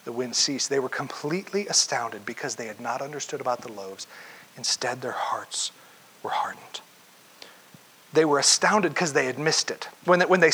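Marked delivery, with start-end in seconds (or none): 2.2-6.8 whispered scripture. Real, not acted. Preacher reads Mark to the congregation, whispered.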